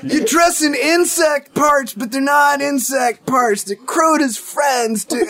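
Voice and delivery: loud, high surfer-dude hype; overexcited bro voice; hyped-up bro. Retold or imitated. Mocking hype of the ad.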